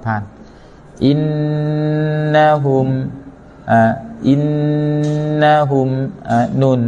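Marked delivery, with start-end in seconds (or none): 1.0-2.9 อิน นะฮุม with a long nasal doubled N; held ghunnah. Shown as a demonstration. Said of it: "ก็คือให้มีกุนนะ". 4.3-5.9 อิน นะฮุม with a long nasal doubled N; held ghunnah. Shown as a demonstration. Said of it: "ก็คือให้มีกุนนะ".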